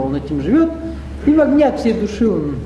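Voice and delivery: ordinary delivery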